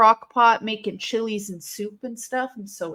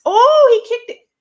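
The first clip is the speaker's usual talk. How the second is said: a much higher, much louder reaction; excited; 14 semitones higher, 12 dB louder than usual. Real, not acted. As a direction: burst out at the kickout. Reacting to a wrestling kickout.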